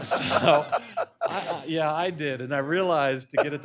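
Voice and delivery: laughing through the words; chuckling while admitting it. Real, not acted. Laughs at distorted photo remark.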